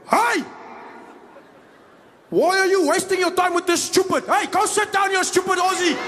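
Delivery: put-on high, shouted Afrikaans-accented telling-off; angry Afrikaans guy. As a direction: explode at a passenger in a thick Afrikaans accent. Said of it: "a big Afrikaans guy who was sitting quiet".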